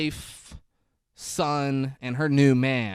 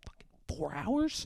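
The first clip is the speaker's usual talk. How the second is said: mock disbelief; 6 semitones higher, 8 dB quieter, slower than usual. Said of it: "he's like".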